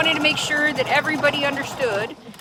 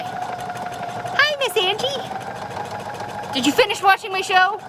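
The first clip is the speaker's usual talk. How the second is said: put-on high, drawn-out little kid voice; child's voice; 10 semitones higher, much slower than usual. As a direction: squeak it like an eager little kid. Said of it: "I was like".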